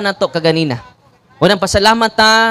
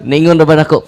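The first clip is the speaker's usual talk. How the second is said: usual speaking voice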